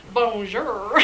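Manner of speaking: a hammed-up French "Bonjour"; mock French accent. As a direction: play the suave French model. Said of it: "He's just like".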